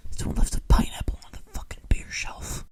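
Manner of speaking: hushed, breathy whisper. Acted. Reader whispering the note to the audience. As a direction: whisper it like a secret. Reading a gross note aloud.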